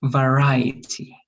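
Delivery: Variety, drawn out slowly; slow model word. Shown as a demonstration. Teacher modelling a word for repetition, slowly. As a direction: say Variety slowly, each syllable clear.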